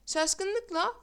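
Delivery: usual speaking voice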